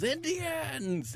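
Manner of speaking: high mock shout of a single word; retold yell. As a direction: yell Indians like a movie hero. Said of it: "Michael J. Fox yells".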